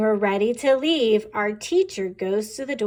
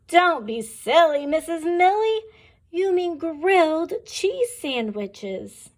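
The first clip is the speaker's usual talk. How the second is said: put-on amused children; 6 semitones higher than usual. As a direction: tease the teacher like giggling pupils. Children correct teacher.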